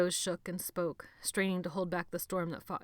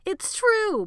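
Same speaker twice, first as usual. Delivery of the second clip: much higher, louder shout; 12 dB louder than usual. A narrator's reading. Benny shouting back at Jonathan. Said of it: "Benny shouted back".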